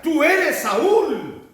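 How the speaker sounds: high, loud and very slow, in shock; stunned recognition. Retold or imitated. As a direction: drag it out, aghast at who he is. Medium recognizes Saul in fear. (reconocimiento atónito, agudo y lento)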